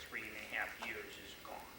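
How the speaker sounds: soft unvoiced whisper; whispered relief. Patient telling the doctor her pain is gone, whispering. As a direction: breathe it out almost silently.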